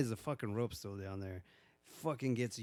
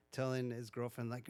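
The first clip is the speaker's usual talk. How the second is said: ordinary talk, nothing marked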